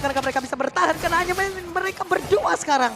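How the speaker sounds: usual speaking voice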